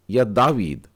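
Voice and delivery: या David with heavy stress on दा; stressed long aa. Shown as a demonstration. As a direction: punch the first syllable of David. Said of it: "जी हाँ, तीसरा वाला।".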